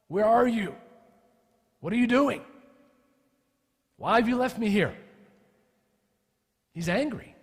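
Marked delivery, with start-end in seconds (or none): 0.1-4.9 higher, louder desperate calling out; anguished cry to God. Acted. Psalmist crying out to an absent God, anguished. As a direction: cry out like a man abandoned.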